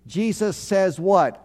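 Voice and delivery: ordinary talk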